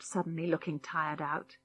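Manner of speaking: plain reading voice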